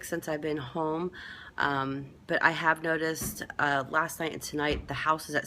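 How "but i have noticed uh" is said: ordinary talk, nothing marked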